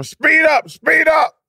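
high, loud barked order to hurry; retold shout. Director in the studio shouting at the voice actor to hurry, loud and high. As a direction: yell it like an impatient studio director. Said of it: "they're screaming at me the whole time".

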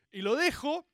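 ordinary talk, nothing marked

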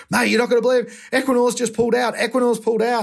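high, fast excited phone call; excited mate breaking news fast. Retold or imitated. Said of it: "he's just like".

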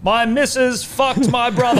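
high, loud, slow crier laughing through it; town crier. Pretend. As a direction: bellow your bad news to the square. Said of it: "the hear ye guy".

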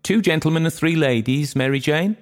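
high-pitched call from an aunt; high, urgent summons to the dance; crying out. A narrator's reading.

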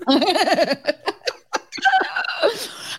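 long, high fluttering laugh; silly laugh. Real, not acted. Podcaster's own trademark laugh after her exclamation, silly. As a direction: let a goofy high laugh run on. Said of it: "that stupid laugh of mine".

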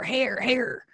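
ordinary talk

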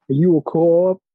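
hammed-up Boston accent. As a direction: do a mock Bostonian question. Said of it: "the Boston accent is just".

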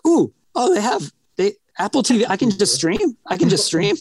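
high-pitched, wide-eyed mock discovery; hammed-up acting. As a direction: act badly amazed at the computer. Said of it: "his acting here is going like".

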